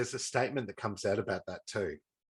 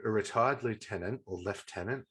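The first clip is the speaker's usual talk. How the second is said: ordinary delivery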